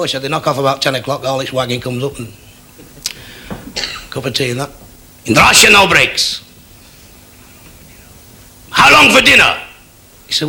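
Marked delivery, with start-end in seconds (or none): 0.2-4.7 softer, offhand explanation; mild reply. Acted. Heath explains the tea break, mild and offhand. 5.2-9.6 higher, emphatic proclamation; demanding Soviet leader. Acted. Kosygin scorns British breaks, loud and demanding. Kosygin boasts and questions Heath.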